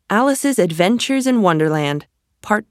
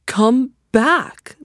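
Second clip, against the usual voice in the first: calling out; 5 semitones higher than usual. A narrator's reading. Caterpillar calling Alice back.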